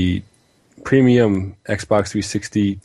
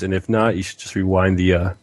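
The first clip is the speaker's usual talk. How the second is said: normal talking voice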